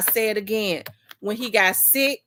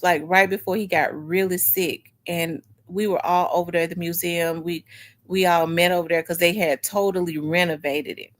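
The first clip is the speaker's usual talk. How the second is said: ordinary talk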